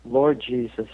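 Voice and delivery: lightly voiced woman calling on Jesus from the gut; a hint of a heartfelt plea; imitating a praying woman. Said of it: "calling on divinity to get her through".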